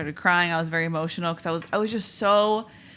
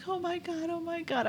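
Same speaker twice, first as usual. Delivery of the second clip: high and much softer, overwhelmed; tearful joy; 10 semitones higher, 8 dB quieter than usual. Retold or imitated. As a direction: breathe it out, overcome with happiness. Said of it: "And I was just like".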